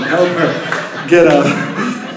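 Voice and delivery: laughing call. Real, not acted. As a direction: laugh while calling for an amen. Pastor laughing, calls for amen.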